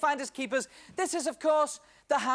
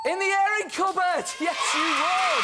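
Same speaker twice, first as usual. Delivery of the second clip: loud, high cheer, shouting the answer; big triumphant game-show shout; excited cheer; 8 semitones higher, 5 dB louder than usual. Real, not acted. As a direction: celebrate the right answer. Host cheers correct answer.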